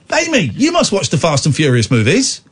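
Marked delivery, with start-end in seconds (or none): none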